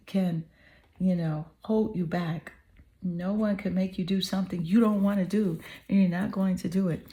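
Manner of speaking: ordinary talk, nothing marked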